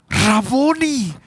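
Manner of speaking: high, slow, drawn-out cry of Raboni; Mary suddenly knowing her teacher; astonished recognition. Acted. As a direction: let the name break out in wonder. Mary recognizes Jesus, crying out.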